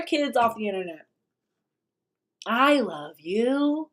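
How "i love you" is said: slow, syrupy mock I love you; mock love bombing. Host mimics influencer telling kids he loves them, slow mock croon. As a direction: drag it out fake-tender. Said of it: "love bombing".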